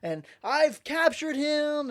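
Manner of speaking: high, loud, drawn-out boasting; hammed-up triumphant announcement; excited bragging. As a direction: crow about your big catch. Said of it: "like, bragging".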